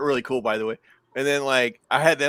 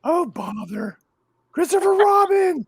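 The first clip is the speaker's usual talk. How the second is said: a light Pooh Bear voice, higher and slow on the name; Winnie the Pooh voice; 14 semitones higher, 5 dB louder, slower than usual. Pretend. Doing a Pooh invitation for friends on a live chat, light Pooh voice. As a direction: gently call for Christopher Robin as a hint of Pooh. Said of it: "Here's my Winnie the Pooh invitation".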